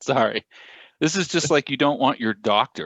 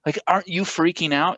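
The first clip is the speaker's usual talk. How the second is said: incredulous; 7 semitones higher than usual. Retold or imitated. Homeowner incredulous quote.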